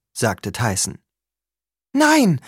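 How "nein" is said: high, loud cry of panic; urgent, alarmed refusal, pitched high; panicked. A narrator's reading. Annabeth refusing to take Tyson along, panicked. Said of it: "hatte jetzt Panik in der Stimme".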